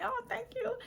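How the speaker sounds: tiny high, soft voice; bashful thanks. Acted. Woman thanking the man who encouraged her, bashful. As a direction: go high and soft, shyly flattered. Coy reply to his compliment.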